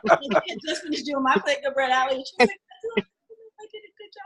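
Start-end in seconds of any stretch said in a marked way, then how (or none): 3.1-4.2 small teary voice. Retold or imitated. Angela quietly after the play.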